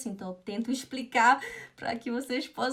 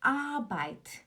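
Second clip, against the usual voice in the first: ARBEIT with a long high first "AH", falling on "bait"; "AAH-bait"; stress on A; 3 semitones higher than usual. Shown as a demonstration. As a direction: lean on the A of Arbeit. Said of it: "O tom tá no A".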